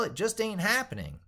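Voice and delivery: ordinary talk, nothing marked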